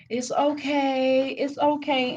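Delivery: high, slow mock reassurance; soothing parent. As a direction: coo it like a parent hushing a child. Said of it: "they be telling their children, like".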